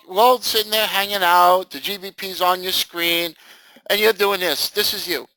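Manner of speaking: usual speaking voice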